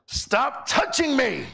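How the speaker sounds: high, slow, put-out plea; a slow whiny stop-it from the man beside him; annoyed seatmate. Retold or imitated. Man on the plane scolding the preacher, high and slow. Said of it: "he turns to me and he goes".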